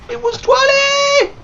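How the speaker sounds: slow, very high falsetto shout; hammed-up squealed complaint; mock shriek. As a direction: shriek it out, stretched.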